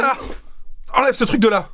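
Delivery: loud frightened yell; panicked shout. Acted. Panics at the talking AI.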